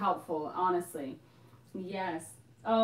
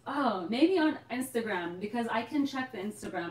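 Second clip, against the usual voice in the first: ordinary talk, nothing marked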